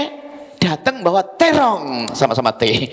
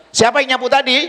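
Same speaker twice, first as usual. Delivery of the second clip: higher, pressing demand on tadi; mock stern mother; 7 semitones higher than usual. Shown as a demonstration. Mother demanding who swept, mock stern. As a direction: interrogate like an annoyed mom. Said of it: "Sang ibu langsung".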